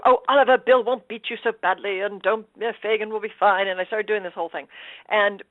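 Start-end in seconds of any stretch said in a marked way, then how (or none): 0.0-3.7 put-on Cockney, lifted and lively; Cockney accent. Auditioner reads Nancy to Oliver, Cockney accent. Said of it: "doing this beautiful Cockney accent".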